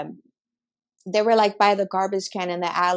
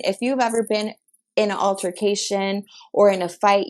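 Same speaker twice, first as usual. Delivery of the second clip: ordinary delivery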